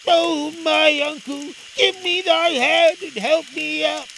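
high, loud, drawn-out plea; imploring boy's call, pitched well up; Aladdin crying out. Acted. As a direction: cry up for a helping hand. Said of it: "he cried".

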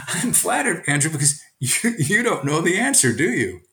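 lightly voiced knowing teacher; playful teacher. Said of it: "teacher replies to student".

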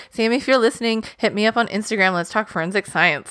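ordinary talk, nothing marked

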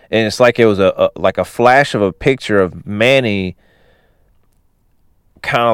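usual speaking voice

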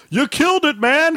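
high-pitched imitation of a kid; mock accusation in a high voice; teasing kid. Dad imitating his kid after the lawnmower died, teasing accusation. Acted kid's quote about lawnmower.

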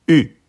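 U short, loud, falling from high; fourth tone on U. Shown as a demonstration. Teacher showing learners Mandarin U, falling tone. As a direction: drop U sharply from the top. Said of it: "Je répète".

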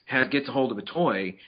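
normal talking voice

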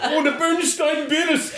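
high, slow mock German accent; laughing, hammed-up German accent; bad German accent. Mocking who wrote the bears, bad German accent. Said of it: "worst G-, German accent ever".

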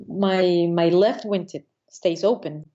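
usual speaking voice

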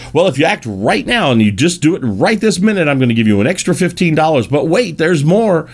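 high-pitched hard-sell pitch; pushy salesman. Retold or imitated. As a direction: rush them into buying now. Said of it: "They don't like to be put into the".

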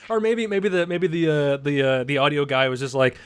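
normal talking voice

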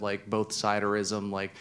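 ordinary talk, nothing marked

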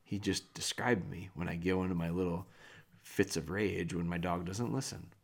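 low, quiet confession; sheepish. Retold or imitated. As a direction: admit it with your head hung. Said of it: "hang their head and go".

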